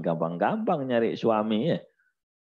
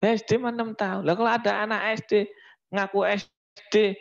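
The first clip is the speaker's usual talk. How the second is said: ordinary delivery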